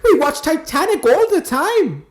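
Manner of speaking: high, loud, slow Irish parroting; mock Irish accent. Echoing the co-host about Titanic, high mock Irish accent. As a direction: echo it in hammed-up high Irish.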